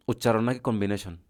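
ordinary delivery

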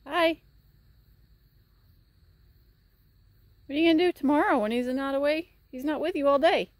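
high, loud pet talk; talking to the horse. Real, not acted. Owner talking to her worried horse, high loud pet-talk.

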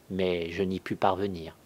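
ordinary delivery, nothing marked

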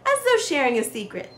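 plain reading voice

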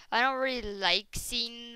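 ordinary talk, nothing marked

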